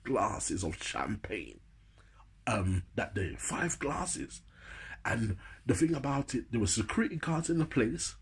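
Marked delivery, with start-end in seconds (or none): none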